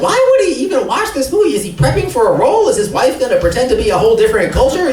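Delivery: high baffled inner voice. Retold or imitated. Retold inner thought, baffled.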